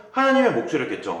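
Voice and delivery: ordinary talk, nothing marked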